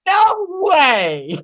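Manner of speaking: high, loud squeal of amazement; squealing disbelief. Retold or imitated. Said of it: "he's like".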